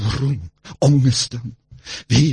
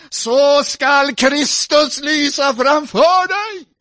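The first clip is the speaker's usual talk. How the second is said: higher and slow, half chanted; intoned scripture; 12 semitones higher, 5 dB louder, slower than usual. Acted. Preacher intoning the promise of Christ. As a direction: lift the verse up like a chant.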